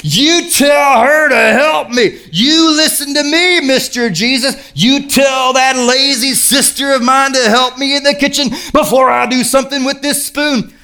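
high, hammed-up angry demand; worked-up Martha. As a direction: order him about in mock outrage, pitched high. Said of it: "Martha's just getting warmed up".

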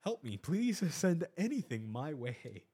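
a nervous man crying out for guidance; anxious plea to God. Retold or imitated. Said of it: "I'm praying to God, to myself".

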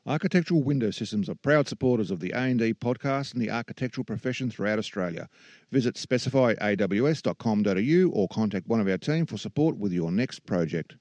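bright, upbeat sponsor read. Acted. Host reads the sponsor message to listeners, upbeat ad voice. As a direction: sell it like a radio spot.

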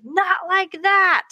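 put-on high, slow, whiny kid snapping at brother; high slow mock brat voice; imitating a bratty kid. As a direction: play the son mocking his brother, high and drawn out. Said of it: "And he'd be like".

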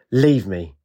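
mild mock roar on Leave me; understated commanding roar. A narrator's reading. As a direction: order them away, not truly deep. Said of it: "In a deep roaring voice, Ajax commanded".

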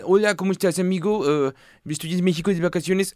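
a French tourist chatting happily in Spanish; French accent. Retold or imitated. A French tourist tells Mexicans about his holiday, in a French accent. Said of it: "un francés hablando español".

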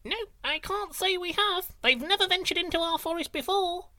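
very high tiny creature voice; squeaky high little snail voice; shouting snail. A narrator's reading. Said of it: "shouted a snail".

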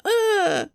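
sudden high mock moan; a short squeaky moan. Imitating Maya in the scene, high moan. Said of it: "she's like".

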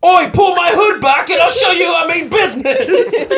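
high, loud, laughing British shout; hammed-up British yell; mock British accent. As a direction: threaten like a cocky English lad. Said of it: "I wish I could do an accent".

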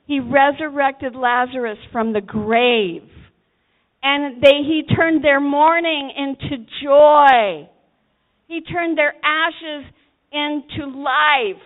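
preaching at full force. Acted. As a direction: preach it up to a fever pitch.

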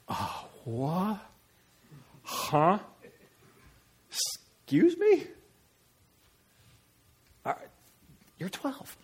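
high, halting, incredulous questions; baffled reaction. Acted. Parent hearing twelve-year-old's answer, baffled and incredulous. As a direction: stammer in hammed-up bewilderment. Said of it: "if your reaction to that is".

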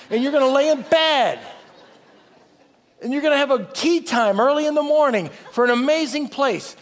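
high-pitched, building sermon climax; impassioned preaching. Real, not acted. Preacher promising congregation a heavenly home, impassioned preaching. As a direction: preach it with rising urgency and stretched key words.

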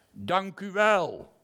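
high, loud, slow call of thanks. Acted. Lion calls his thanks back to the narrator, high and loud.

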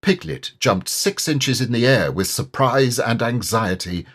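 ordinary delivery, nothing marked

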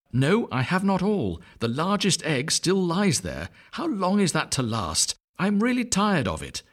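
weary mother duck. A narrator's reading. Mother duck counting eggs, high and weary. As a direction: sigh through it, fed up with waiting.

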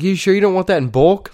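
high, loud mocking question; mocking tease. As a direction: needle him sarcastically about bulk. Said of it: "I was like".

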